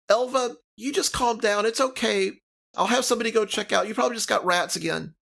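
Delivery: high, quick soothing halfling voice; calm her down in a squeaky hurry; Nimsy soothing. Acted. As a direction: hostess placating a cranky neighbor, high and fast.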